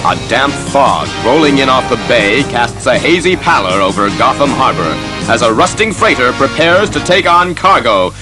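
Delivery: ordinary delivery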